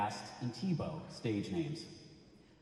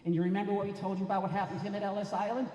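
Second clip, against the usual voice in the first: higher, quick mom voice; prompting mother; 6 semitones higher, much faster than usual. Retold or imitated. Mom prompting her son about Ellis Island, quick. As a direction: rattle off a mother's reminder question.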